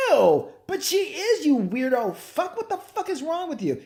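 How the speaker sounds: high, hammed-up disgusted squawk; disgusted reaction. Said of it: "they were like".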